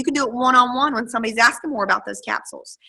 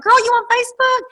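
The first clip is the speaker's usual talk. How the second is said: much higher and louder, ending in a shout; excited girl-talk; 13 semitones higher, 5 dB louder than usual. Retold or imitated. Asking a stranger to add her on Facebook, excited high squeal. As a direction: squeal it at a new friend. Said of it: "You're like".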